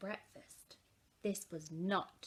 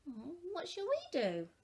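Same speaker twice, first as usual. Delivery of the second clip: put-on high whiny child; child's voice; 8 semitones higher than usual. A narrator's reading. Laura asking Mum what to do, high whiny child.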